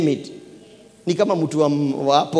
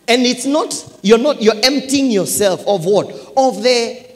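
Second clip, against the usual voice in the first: raised, higher preaching voice; forceful preaching; 7 semitones higher, 6 dB louder than usual. Real, not acted. Preacher tells church he emptied himself, forceful preaching.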